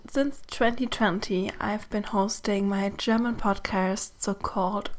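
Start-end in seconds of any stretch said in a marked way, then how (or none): none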